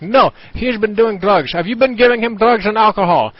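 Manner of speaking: high, loud mock outrage from an accusing mother; shrill accusing mom. Imitating the friend's mother accusing him of drugging her son. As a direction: shriek the accusation like a furious parent.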